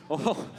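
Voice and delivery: low nervous chuckling, acted; put-on nervous laugh trailing on; mock nervous laughter. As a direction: imitate uneasy passengers laughing it off. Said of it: "like, laughing nervously".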